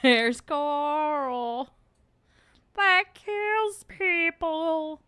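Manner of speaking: high, drawn-out silly voice; put-on character voice. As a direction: wail the words slowly in a high cartoonish tone. Quotes a line in put-on voice.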